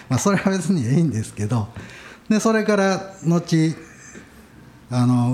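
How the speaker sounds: ordinary talk, nothing marked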